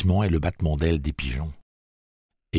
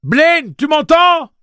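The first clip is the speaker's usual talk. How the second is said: appel crié; 14 dB louder, much slower than usual. A narrator's reading. Suzanne appelle Blaine.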